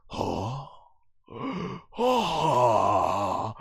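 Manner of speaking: breath-caught gasps, the last one long; shocked gasps. Retold or imitated. Vegeta reacting to Bluma's big line, shocked gasps. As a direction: gasp in exaggerated disbelief.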